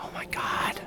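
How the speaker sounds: gasp, then whispered mock amazement; awed whisper. Vlogger revealing royal shield to viewers, whispered mock awe. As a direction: whisper it in hammed-up astonishment.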